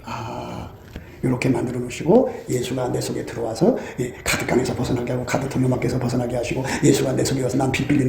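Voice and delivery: low, soft, steady inner prayer; quiet awed realization. Retold or imitated. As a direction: murmur the discovery to yourself. (낮고 차분하게 깨달음을 되뇌는 기도)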